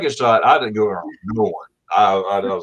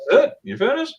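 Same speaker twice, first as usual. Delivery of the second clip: high, amazed disbelief; retold surprise at how quick it was; surprised; 13 semitones higher than usual. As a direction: pitch it up in mock astonishment. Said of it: "I was surprised".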